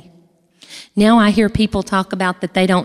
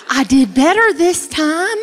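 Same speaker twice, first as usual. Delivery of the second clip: proud joke; 8 semitones higher, 3 dB louder than usual. Real, not acted. Joke about dating relatives.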